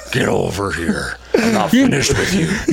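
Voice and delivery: slow, menacing video game ninja growl; Scorpion voice. Retold or imitated. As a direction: bellow it like a Mortal Kombat ninja. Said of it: "he has Scorpion voice already".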